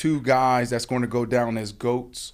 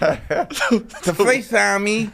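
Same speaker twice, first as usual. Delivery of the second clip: Shannon Sharpe impression; 9 semitones higher, 5 dB louder than usual. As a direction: lisp it like Mike Tyson. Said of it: "You sound like Mike Tyson".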